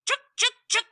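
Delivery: high chattering squirrel scold; sharp high squirrel chuks. Acted. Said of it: "she'd say".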